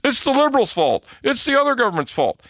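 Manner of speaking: high, fast mock blaming; rapid high-pitched mimicry of a complainer; mocking objectors. Acting out objectors' blame.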